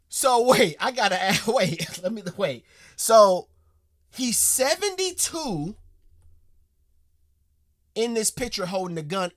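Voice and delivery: loud, high, stunned questioning; bursting with amazement; excited disbelief. Real, not acted. Asking viewers about the seventy-two-year-old, loud excited disbelief.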